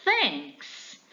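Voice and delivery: lightly voiced dialogue read in English. Shown as a demonstration. Teacher reading a sample dialogue aloud, lightly voiced. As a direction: read both speakers in the English exchange. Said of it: "o primeiro diálogo vai ser".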